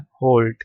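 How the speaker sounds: "halt" with a rounded O, like "holt". Shown as a demonstration. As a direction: say "halt" to rhyme with "bolt". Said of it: "pronounce ऐसे करना है".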